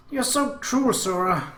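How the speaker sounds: acted hurt, in a high little-sister voice; high, wounded accusation, mildly tearful; sobbing. A narrator's reading. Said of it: "Akemi sobbed".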